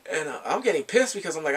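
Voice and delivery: ordinary talk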